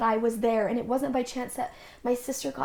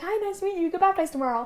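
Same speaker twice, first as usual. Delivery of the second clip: much higher, bright and bouncy; cheery greeting; 7 semitones higher, much faster than usual. Retold or imitated. New missionary greets someone baptized tomorrow, cheery. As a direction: greet them with surprised cheer. Meeting convert day before baptism.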